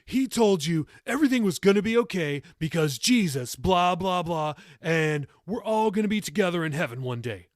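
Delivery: high, loud mocking singsong; mock her faith with contempt; dismissive teen sneering at heaven; sarcastic mockery. A narrator's reading. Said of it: "Jacob inflected sarcastically".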